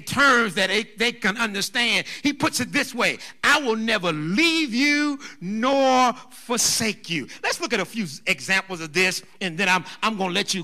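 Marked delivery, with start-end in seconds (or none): 3.4-7.3 "I will never leave you nor forsake you" slow and drawn out, each phrase weighted; emphatic promise. Acted. Preacher voicing God reassuring his people, emphatic promise. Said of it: "He emphatically tells them".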